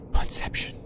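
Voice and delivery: hushed, breathy whisper of the name. Pretend. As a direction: whisper it like a secret. Repeats move name in whisper.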